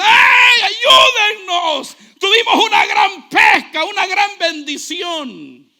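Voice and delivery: crying out high and loud for help; frantic shout. Acted. Pedro shouting to the others for help, high and loud. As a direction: yell like a man whose boat is sinking. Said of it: "Pedro como un loco empieza".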